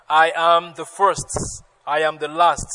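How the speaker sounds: loud, measured Bible reading; emphatic pulpit reading; scripture reading. Acted. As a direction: proclaim the verse to the congregation. Pastor reads scripture aloud.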